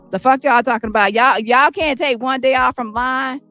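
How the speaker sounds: usual speaking voice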